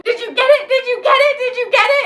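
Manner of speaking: high, loud frantic repeated question; frantic yelling. Retold or imitated. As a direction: yell it over and over in panic. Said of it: "sitting here yelling".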